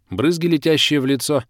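ordinary delivery

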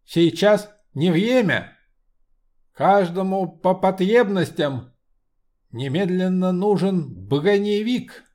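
slow, commanding metallic voice; steely voice. Acted. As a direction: bark orders like an iron automaton. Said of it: "Стальной голос гомункулуса".

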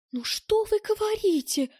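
high, slow, shocked lady's voice; as if she heard something vulgar; indignant. A narrator's reading. Round-eyed woman, shocked by a remark, indignant. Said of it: "возмущённо, будто услышала пошлость".

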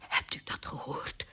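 trembling, barely voiced whisper; too scared to speak aloud; frightened whisper. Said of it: "fluisterde de burgemeester met trillende stem".

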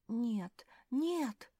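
usual speaking voice

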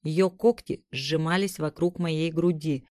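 ordinary delivery

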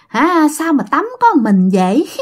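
high-pitched ghostly tease with rising lilt; mock teasing. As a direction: imitate a ghost flirtily teasing a bather. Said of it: "Nó ghẹo ông Năm vậy đó".

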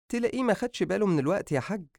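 high, fast placating voice; pleading mother. Acted. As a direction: hurry to excuse the son. Mother excuses son to husband.

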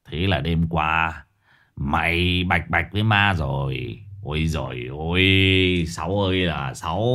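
slow, low, drawn-out sigh; long-drawn, head-shaking pity; mock lament. A narrator's reading. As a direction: stretch each word in amused dismay. Boss laments the victim's folly.

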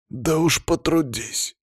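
high, slow, strained growl of a boss; drawn-out, irritated boss; forced out. A narrator's reading. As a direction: squeeze it out with held-back anger. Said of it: "выдавил Палыч".

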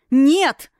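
screaming at full power, high. A narrator's reading. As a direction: scream no with all your might. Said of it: "завопила Юна во всю мощь".

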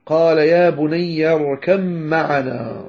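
"اركب معنا" as irkam-ma'ana, slow held m; merged recitation. Shown as a demonstration. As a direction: recite slowly, b of "اركب" melting into a long m. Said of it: "فأدغمها".

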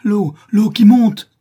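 very high, very loud, slow scream of fright; cry of terror. A narrator's reading. The girl sees the water rising and screams in terror. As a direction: shriek as the flood rises. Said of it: "un cri d'effroi échappe à la jeune fille".

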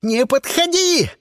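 high, drawn-out panicked roar; desperate, strained yell. A narrator's reading. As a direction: roar the warning to keep back. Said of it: "взревел Сиприт".

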